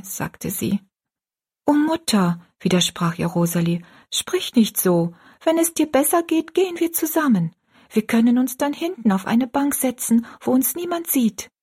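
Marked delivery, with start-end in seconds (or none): 1.7-2.4 put-on high child's voice, pleading; protesting young daughter. 4.1-11.5 put-on high girlish voice, consoling her mother; pleading young daughter.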